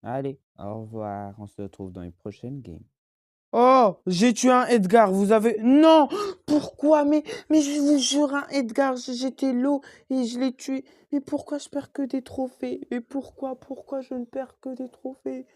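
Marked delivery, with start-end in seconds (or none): 3.5-15.5 high, loud exasperated yelling; frustrated rage. Real, not acted. Gamer losing trophies yells at the game in high, loud frustration. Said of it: "Ça m'énerve".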